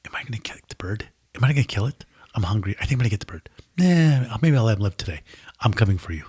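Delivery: low hushed plotting whisper; cat eyeing the bird, low scheming hush. Retold or imitated. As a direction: mutter it like a cat weighing a kill. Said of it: "It's like".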